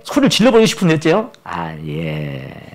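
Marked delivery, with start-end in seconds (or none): 1.5-2.7 meek yes. Acted. Meek compliant reply.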